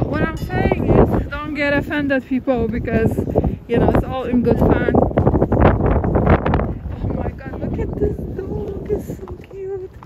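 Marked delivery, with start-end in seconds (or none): 4.9-9.9 cooing over dog. Real, not acted.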